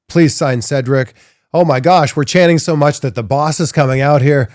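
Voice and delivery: excited crowd. Retold or imitated. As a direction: hype it like a cheering crowd. Host voicing the chanting crowd.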